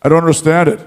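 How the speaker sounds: lightly voiced puzzled wrestler; a hint of mock bafflement; Shawn Michaels. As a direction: imitate a wrestler's complaint in a put-on voice. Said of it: "And Shawn kept hearing that".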